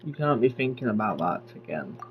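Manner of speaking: ordinary delivery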